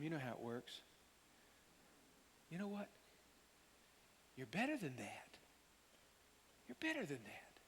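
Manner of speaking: softly encouraging, kind correction; gentle, tender. Pretend. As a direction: speak like a loving friend. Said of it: "he might have said something like this".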